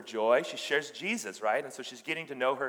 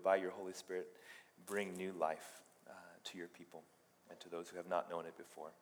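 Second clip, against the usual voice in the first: low, soft, halting prayer; 6 semitones lower, 10 dB quieter than usual. Real, not acted. Preacher asking God's help before teaching, hushed prayer. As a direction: pray quietly and humbly, pausing between phrases.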